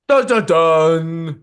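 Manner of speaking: high, loud suspense fanfare; dramatic dun dun dun. Pretend. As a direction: sing it like a dramatic sting. Dramatic drumroll sound.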